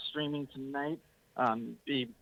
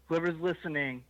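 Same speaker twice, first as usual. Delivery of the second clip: ordinary talk, nothing marked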